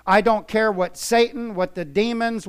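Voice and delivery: ordinary talk, nothing marked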